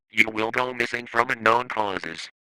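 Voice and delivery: ordinary delivery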